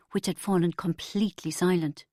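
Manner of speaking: usual speaking voice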